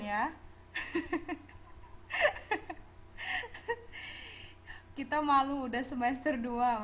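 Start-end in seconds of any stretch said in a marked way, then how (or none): 0.4-4.9 long breathy, high-pitched laugh; real helpless giggling, mostly voiceless. Real, not acted. Teacher amused by students' wrong verb reading, laughing.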